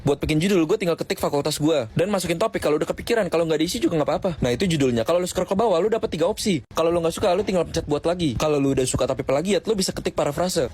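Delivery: upbeat, quick sponsor read. Acted. Walking listeners through the thesis website, upbeat ad read. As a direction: sell the website brightly.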